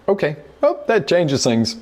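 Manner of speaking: well jumps way up in mock surprise; sudden realization. The young owner realizing the visitor is his auditor, mock surprised. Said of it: "I'm like".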